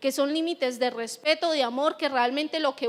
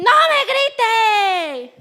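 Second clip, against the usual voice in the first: much higher and louder, very slow, drawn-out yell; shouted scolding; 7 semitones higher, 10 dB louder, much slower than usual. Retold or imitated. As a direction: yell at the child to stop yelling. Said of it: "usted le dice". (grito exagerado de regaño)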